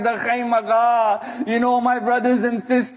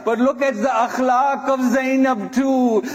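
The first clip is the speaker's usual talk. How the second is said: ordinary talk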